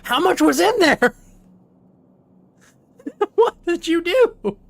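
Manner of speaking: alarmed mom. Retold or imitated. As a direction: recoil at the mess, begging. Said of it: "It's like".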